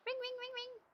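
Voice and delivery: a wistful little ringing sound under her breath; soft phone ring imitation. Streamer wishes the cut phone would ring, softly mimicking it.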